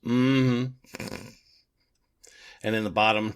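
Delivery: ordinary delivery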